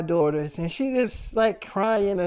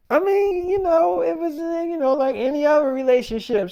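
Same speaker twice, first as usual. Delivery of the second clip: squeaky, innocent dodge, pitched way up; imitating his own nervous high-pitched dodge; evasive high voice; 8 semitones higher than usual. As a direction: play dumb in a high voice. Dodging wife's question in retold argument.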